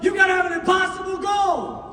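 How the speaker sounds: replaying a mentor's line, shouting it; repeated shout. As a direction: shout it like a mantra. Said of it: "Man, play that back".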